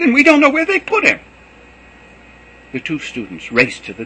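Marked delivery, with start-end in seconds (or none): 0.0-1.2 high, loud frantic cry; cried out. Acted. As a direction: wail it in distress. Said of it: "she cried".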